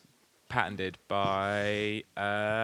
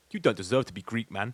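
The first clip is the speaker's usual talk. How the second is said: wannabe gangster; 2 semitones lower, 3 dB quieter than usual. Retold or imitated. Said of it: "hard basic roadman voices".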